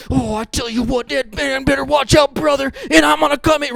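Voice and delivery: high, loud, roaring wrestler promo; wrestler hype promo. Retold or imitated. Pastor mimics a wrestler's hype video, roaring. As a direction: pump it up like an eighties wrestler. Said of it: "the hype videos".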